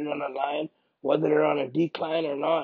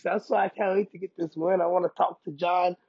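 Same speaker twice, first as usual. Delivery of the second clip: usual speaking voice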